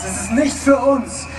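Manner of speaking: ordinary delivery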